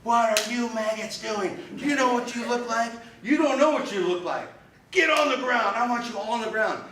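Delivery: drill instructor yelling. Retold or imitated. Platoon leader screams at recruits who fell over, furious high yell. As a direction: tear into the recruits like an enraged instructor. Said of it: "he started yelling at us".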